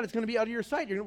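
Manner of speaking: ordinary delivery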